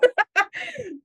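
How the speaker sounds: very high squeal of laughter; real laugh. Real, not acted. Coach laughing at the horror of self-doubt. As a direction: let a high laugh burst out. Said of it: "And I'm laughing".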